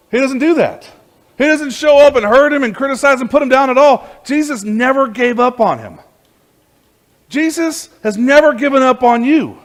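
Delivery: loud, high, impassioned preaching; preaching at full force. Acted. Preacher to his congregation: Jesus never gave up, full-force preaching.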